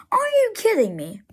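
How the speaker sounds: high, exasperated outburst; frustrated. Real, not acted. Narrator fed up with which towns the sign lists, frustrated. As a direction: burst out high in disbelief, really fed up. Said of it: "I'm frustrated".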